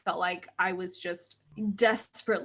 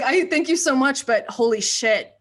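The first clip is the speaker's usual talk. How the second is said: retold polite panic, loud and raised; mock overwhelmed; 4 semitones higher, 8 dB louder than usual. Producer retelling her reaction to extra pages, mock overwhelmed. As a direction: play up the overwhelmed thanks.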